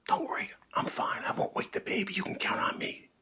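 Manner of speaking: unvoiced whisper. Dad reassuring mom in a careful whisper. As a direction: whisper it so the baby sleeps. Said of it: "said Dad".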